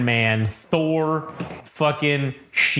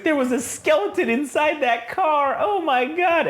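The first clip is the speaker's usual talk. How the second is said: high-pitched mock-horrified woman; alarmed wife; 12 semitones higher than usual. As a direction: wail it like a shocked wife. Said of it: "then the wife's like".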